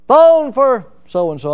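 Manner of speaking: loud, slow call down the hallway; drawn-out hallway call. Retold or imitated. Calling a roommate down the hallway.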